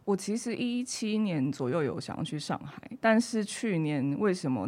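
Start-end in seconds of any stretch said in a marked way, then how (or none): none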